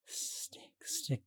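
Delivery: whispered stammer. Acted. Dazed stammering.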